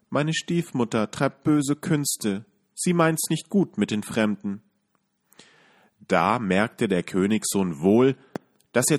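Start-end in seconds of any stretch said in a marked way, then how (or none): 0.1-4.6 put-on sighing girl. A narrator's reading. Girl tells the prince about her stepmother, sighing. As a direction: confess it with a heavy sigh. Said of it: "Das Mädchen seufzte und sprach".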